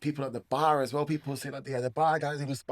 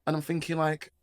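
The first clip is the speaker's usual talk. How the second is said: ordinary delivery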